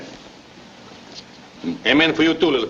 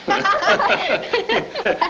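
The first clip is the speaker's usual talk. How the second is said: laugh; 13 semitones higher than usual. Acted. Grandfather laughing with child.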